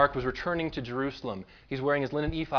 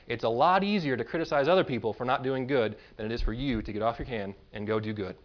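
ordinary talk

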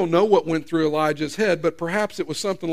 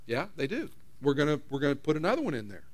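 softer and quick, matter-of-fact; God shrugging off Elijah's objection; offhand God; 5 semitones lower, 9 dB quieter, faster than usual. Acted. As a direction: toss it off casually. God casually answering Elijah.

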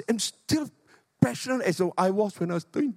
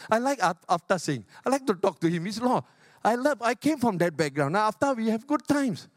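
ordinary talk

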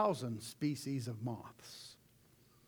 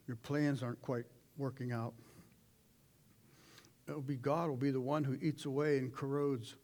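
ordinary talk, nothing marked

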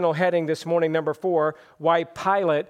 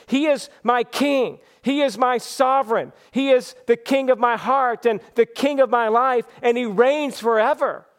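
raised pitch, preacher's proclamation; fervent declaration; 4 semitones higher than usual. Real, not acted. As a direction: proclaim each phrase with conviction.

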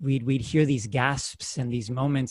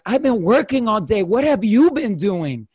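louder, higher mock wife snapping back; acted marital quarrel, raised voice; mock argument; 6 semitones higher, 6 dB louder than usual. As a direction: fight back as the tired wife. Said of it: "my mom being like".

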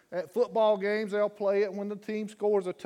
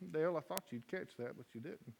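ordinary delivery

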